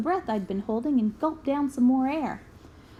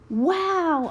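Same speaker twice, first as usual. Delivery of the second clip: put-on child's wow; 5 semitones higher, 3 dB louder than usual. A narrator's reading. Child amazed by watch offer.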